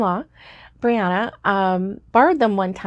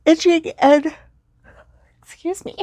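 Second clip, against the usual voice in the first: talking through a yawn; words stretched by a yawn; 8 semitones higher, 4 dB louder than usual. Real, not acted. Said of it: "I did yawn".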